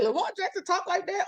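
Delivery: high mock gasp of disbelief; mock shocked public. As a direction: play the scandalised critic, pitch shooting up. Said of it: "You'll be like".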